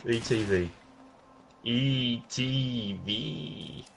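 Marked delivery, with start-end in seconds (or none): 1.6-3.8 very slow, soft spelling out; each letter of "E T V" held long; drawn-out letters. Pretend. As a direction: stretch "E T V" letter by letter. Playing on the initials E T.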